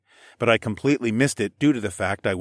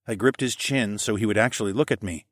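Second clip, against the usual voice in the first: ordinary delivery, nothing marked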